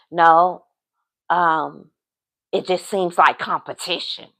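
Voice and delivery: low, soft, halting imitation of him; reluctant admission. Man admitting he sees her friend as competition, low and hesitant. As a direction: hedge it quietly in a low voice.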